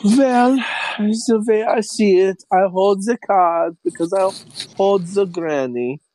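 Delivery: high, slow, hammed-up German accent; smug German villain. Villain holding the granny as leverage, smug German accent. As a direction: gloat slowly in a bad German accent. Plays German hostage-taker stating his leverage.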